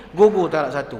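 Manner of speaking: ordinary talk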